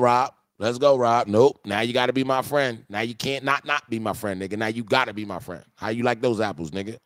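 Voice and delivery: ordinary delivery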